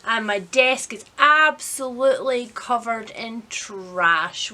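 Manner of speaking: normal talking voice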